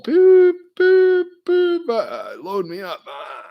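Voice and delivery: high, loud goofy boops; squeaky mock glutton; silly hungry voice. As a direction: play a greedy cartoon eater. Shovelling food, silly noises.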